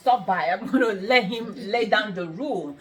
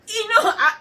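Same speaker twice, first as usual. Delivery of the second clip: high burst of laughter breaking into words; real laugh; 10 semitones higher, 4 dB louder than usual. Real, not acted. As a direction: laugh at your own impression. Laughs at own impression.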